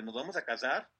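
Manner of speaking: puzzled question, rising at the end; retold disbelief. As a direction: echo it back as a mildly stunned question. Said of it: "se quedó así como de".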